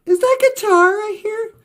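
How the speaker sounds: lightly voiced little creature asking; curious little critter voice; little Cutie voice. A narrator's reading. Cutie voiced in told story.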